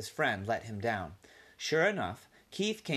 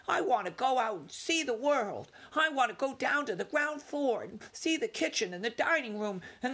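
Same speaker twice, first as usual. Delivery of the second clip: put-on high, bold young mouse longing for adventure; young mouse voice full of wanderlust; eager young mouse Ralph; 11 semitones higher than usual. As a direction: sell her on your big dreams. Ralph dreams of the world.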